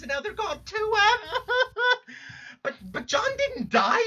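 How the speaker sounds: high, cracking sob; breaking into sobs. Acted. Jade remembers losing her friends, sobbing high. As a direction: let the voice crack into crying. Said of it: "this fucking crying's making me lightheaded".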